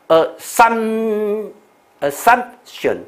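assumption with a long held SUM, then a stop; high, slow model of assumption; pause before silent p. Shown as a demonstration. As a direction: hold assumption at the m, swallow the p. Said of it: "这个顿一下，p不出音".

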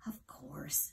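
soft whispery dormouse; quiet little creature, sure of itself. A narrator's reading. Dormouse voice in story.

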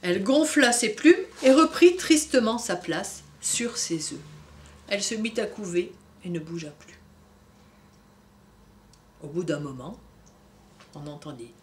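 put-on gentle storybook reading for kids; story narration. A narrator's reading. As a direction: read it like a bedtime book. Reading picture book to children. (lecture d'histoire pour enfants)

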